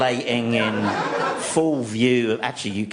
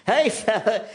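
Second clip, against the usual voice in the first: soothing coax; 8 semitones higher than usual. Retold or imitated. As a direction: gently talk a towering bear down. Man calming towering bear.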